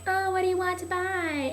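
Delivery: high, put-on store clerk voice; flirty shopgirl imitation.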